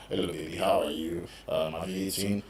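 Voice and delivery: low, soft, smooth lover's voice; bass boy voice. Retold or imitated. Boyfriend sweet-talking his girlfriend, in a low, soft croon. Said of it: "bass boys".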